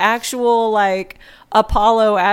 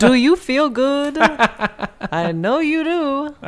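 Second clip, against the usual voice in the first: higher, slow and teasing with a laugh; mock cabaret emcee; 6 semitones higher, slower than usual. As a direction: purr it like a sleazy stage host.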